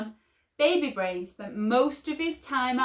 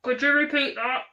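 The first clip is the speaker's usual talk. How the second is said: ground control; 5 semitones higher, 4 dB louder than usual. A narrator's reading.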